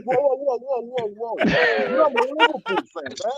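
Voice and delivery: high-pitched mock disbelief; retold shocked protest. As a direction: re-enact being stunned, voice shooting up high. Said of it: "I'm like".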